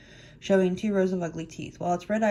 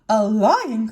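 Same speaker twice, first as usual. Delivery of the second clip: loud, startled exclamation; alarmed cry; 2 semitones higher, 9 dB louder than usual. A narrator's reading. Mouse queen hearing of the lion, alarmed cry. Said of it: "cried the queen".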